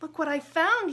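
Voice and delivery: high, loud proud call; excited boast. Acted. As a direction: show it off proudly. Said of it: "he said".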